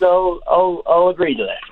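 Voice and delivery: high Southern Shore voice, imitating the player; Southern Shore twang. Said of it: "in her Southern Shore twang".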